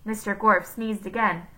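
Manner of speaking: usual speaking voice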